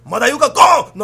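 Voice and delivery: loud furious outburst; angry shout. Acted. Wife hitting the child, loud furious yell. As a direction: bellow it as you strike.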